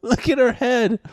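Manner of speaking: very high laugh into the words; scream-laugh at the screen; shrieking laugh. Real, not acted.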